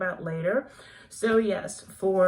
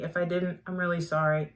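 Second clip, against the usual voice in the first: usual speaking voice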